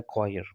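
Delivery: "क्वायर" starting with a k sound; correct pronunciation. Shown as a demonstration. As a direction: say "क्वायर" as kwa-yer. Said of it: "तो उसे कहा जाता है". ("क्वायर", क से सही उच्चारण)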